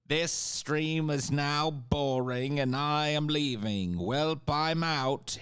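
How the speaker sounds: high, slow whiny chatter impression; hammed-up bored viewer leaving; mocking chatter voice. Mocking chatters announcing departure.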